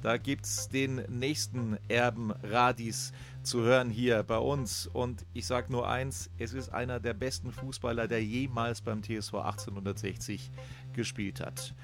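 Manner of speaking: ordinary delivery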